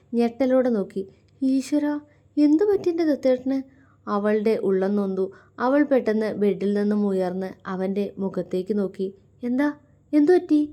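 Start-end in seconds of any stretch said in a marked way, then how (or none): 1.5-3.6 higher, startled and worried; alarmed cry. Acted. Mitra seeing her husband's tears, alarmed and pitched up. Mitra sees him crying. 9.4-10.6 higher, louder worried question; anxious asking. Acted. Mitra begging Shiva to say what is wrong, anxious and raised. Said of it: "അവൾ ആകുലതയോടെ ചോദിച്ചു".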